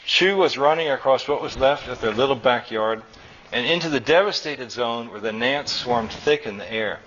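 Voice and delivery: usual speaking voice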